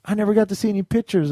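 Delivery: a hint of a mother gently complaining; mom's voice. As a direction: mom saying she never saw the vacation pictures. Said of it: "she was like".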